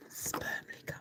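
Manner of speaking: a sly, breathy whispered jab; whispered insult. Real, not acted. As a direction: drop to a whisper for the last dig. Whispered insult after rant.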